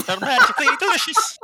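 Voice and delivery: hammed-up Irish jingle voice, high and loud; sing-song fake Irish, laughing through it; cringey mock Irish cereal mascot; badly done Irish accent. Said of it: "badly done Irish accent".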